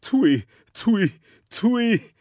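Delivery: very high, loud chuckle; chuckling. Acted. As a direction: cackle it out high. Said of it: "chuckled the sky god".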